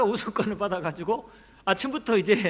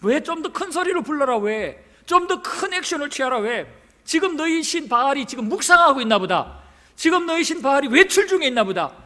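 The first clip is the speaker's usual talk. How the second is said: raised, increasingly loud mocking taunts; Elijah; 5 semitones higher, 5 dB louder than usual. Elijah taunting the Baal prophets, openly mocking. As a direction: jeer and needle them, voice pitched up. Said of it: "조롱을 하기 시작합니다".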